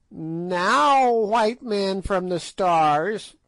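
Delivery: put-on high, slow cackling old witch voice; piping witch. A narrator's reading. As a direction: pipe it out like an ancient sly witch. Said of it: "she piped".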